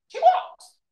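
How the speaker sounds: "She walks" pitched very high and loud; emphatic correction. Real, not acted. Lecturer correcting that she did not run, "She walks" high and emphatic.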